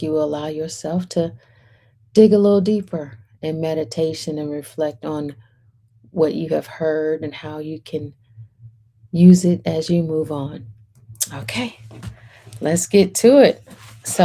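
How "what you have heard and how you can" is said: usual speaking voice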